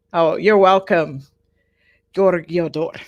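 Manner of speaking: ordinary delivery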